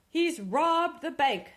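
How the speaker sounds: high, loud, slow cry; outraged shout. Acted. Mayor cries out at the robber, loud.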